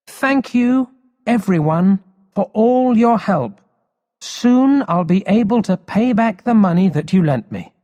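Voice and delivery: put-on grateful boy. Emil thanking his friends, high and glad. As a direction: thank them like a happy schoolboy.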